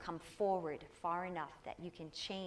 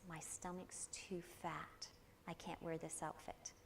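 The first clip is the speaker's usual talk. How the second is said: softer, slow, a hint of mother's glum self-talk; imitating mother's complaint; 11 dB quieter than usual. Quoting her mother's body talk, softly and slowly. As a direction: let it drop quiet like a private complaint. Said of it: "That was my mother's words".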